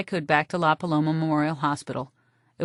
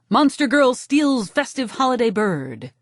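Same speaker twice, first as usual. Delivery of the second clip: headline voice; 5 semitones higher, 4 dB louder than usual. Acted. Tabloid headline read out.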